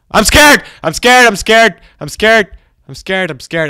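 high panicked repeated cries, gradually easing; scared yelling. Real, not acted. Player facing a floating monster, scared yelling. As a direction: start at a loud yelp, ease slightly. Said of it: "Okay, I'm scared now".

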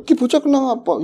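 high, loud alarmed cry; startled, seeing the girl on the ground; alarmed question. Retold or imitated. Ki Waslam alarmed at the fainted girl. (teriakan kaget yang tinggi)

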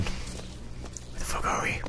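slow hushed whisper; awed whisper. Real, not acted. A player stepping into a strange place, whispering in awe. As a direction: breathe it out in wonder. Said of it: "awed at new place".

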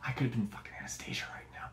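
hushed, bitter whisper; husband whispering his regrets to himself. Retold or imitated. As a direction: breathe it under your breath. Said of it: "I'm like".

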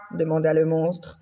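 ordinary delivery, nothing marked

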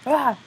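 short, high, loud "ah"; quick mock scream; imitated jumpscare cry. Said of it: "he was like".

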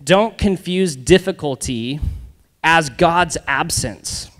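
slow, deliberate line to remember; slow emphatic. Shown as a demonstration. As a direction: lay each word down slowly for the crowd. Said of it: "remember this".

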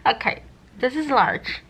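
speaking with lips stretched; lips held open. Real, not acted. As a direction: through a cheek retractor. Seen 3: Plastic retractor holds lips wide open, teeth bared, throughout.

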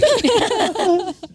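high, loud laugh; burst of laughter. Real, not acted. Laughing at being compared to Suzy, real high laugh.

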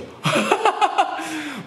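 high burst of laughter. Real, not acted. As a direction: crack up at your own imitation. Laughs at own imitation. (高い声で吹き出して笑う)

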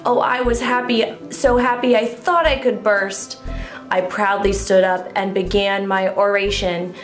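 plain reading voice

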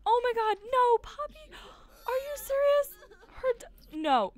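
loud, high, tearful outcry; real crying panic. Real, not acted. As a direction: cry out as it happens. Watching her Sim die.

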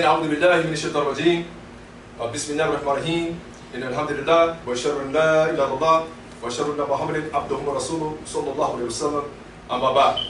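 low, rapid recited formula; hushed, hurried devotional recitation; Arabic opening invocation. Real, not acted.

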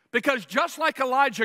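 ordinary talk, nothing marked

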